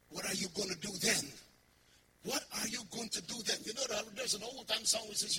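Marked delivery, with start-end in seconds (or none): none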